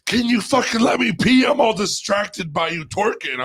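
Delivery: high, loud exasperated mock yell; Jessica. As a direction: imitate someone shouting to be left alone. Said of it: "And Jessica's like".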